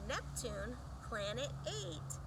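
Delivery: usual speaking voice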